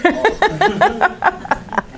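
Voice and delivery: high chuckling laugh; amused laugh. Real, not acted. Teacher laughing at a stray mark, amused laugh. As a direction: chuckle in a quick run.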